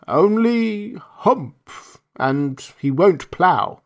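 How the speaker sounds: slow, heavy, plodding ox; a dull ox grumbling slowly. Acted. Ox speaks.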